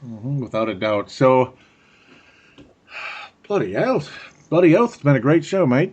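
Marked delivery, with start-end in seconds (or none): 3.5-5.9 higher-pitched, hammed-up Australian; Aussie accent. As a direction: sign off in a put-on Aussie accent. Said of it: "you gotta sign off in the Aussie accent".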